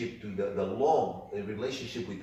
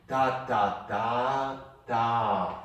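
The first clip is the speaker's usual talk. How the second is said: ta ta ta ta clapped out in rhythm; rhythmic syllables, short short long longer; rhythm demo; 2 semitones higher, 5 dB louder, much slower than usual. Shown as a demonstration. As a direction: sound out the rhythm for the student. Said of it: "Say it again".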